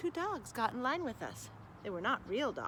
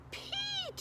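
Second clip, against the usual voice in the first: very high, calling shout. Acted. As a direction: call out gleefully. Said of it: "shouted all the children".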